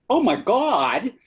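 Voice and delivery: put-on high, drawn-out Valley girl gasp. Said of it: "she said it like a Valley girl".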